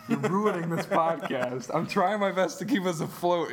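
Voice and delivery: ordinary talk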